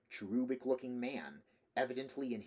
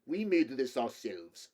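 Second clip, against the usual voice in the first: put-on louder Slavic-accented boast; proud Slavic accent; 5 dB louder than usual. A narrator's reading. Russian man boasts of the homemade gun, proud Slavic accent. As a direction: beam with pride in a Slavic accent. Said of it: "beaming like a Slavic Santa".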